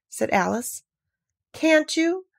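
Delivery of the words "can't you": high-pitched pitying question; pitying, raised voice. A narrator's reading. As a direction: coo it up high with pity. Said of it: "said in a pitying tone".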